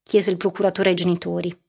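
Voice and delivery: ordinary delivery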